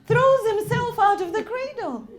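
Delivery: loud, impassioned sermon voice; preaching at full force. Acted. Preacher recounting Karbala to mourners, impassioned and loud. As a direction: cry it out to the congregation.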